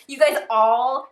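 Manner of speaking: loud, drawn-out imitation of his accent; Chan's accent. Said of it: "he had, like, that accent".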